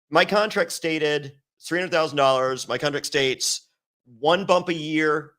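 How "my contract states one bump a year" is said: slow, weighted words laying down the terms; deliberate emphasis. Real, not acted. Telling the host his contract terms, slow and deliberate. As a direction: stress each word of the contract term.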